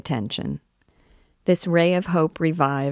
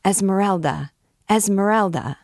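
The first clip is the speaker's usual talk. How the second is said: higher, lightly acted crowd cry; repeated cry; 4 semitones higher than usual. A narrator's reading. Everyone at the windows repeating the cry, mildly shouted. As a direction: call the name out, pitched up. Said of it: "This cry had a magical effect".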